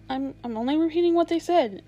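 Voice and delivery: mildly panicked stammer. A narrator's reading. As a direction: stumble on the first word, nervously defensive. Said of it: "Edmund stammered".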